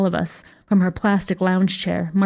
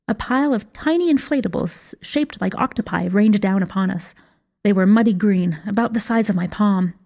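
plain reading voice

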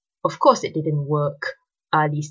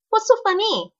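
sharp, higher, offended demand; 4 dB louder than usual. A narrator's reading. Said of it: "Ali demanded".

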